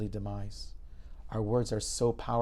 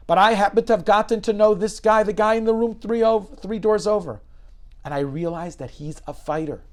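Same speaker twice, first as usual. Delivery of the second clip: louder and higher, full of conviction; impassioned declaration; 9 semitones higher, 10 dB louder than usual. Retold or imitated. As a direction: argue for him with fire. Doctor passionately defends patient.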